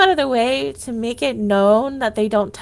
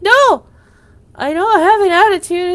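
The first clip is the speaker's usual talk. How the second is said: much louder, higher defiant retort; loud higher pitched defiant reply; 6 semitones higher, 8 dB louder than usual. Retold or imitated. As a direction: snap back defiantly. Retold loud retort to coworkers.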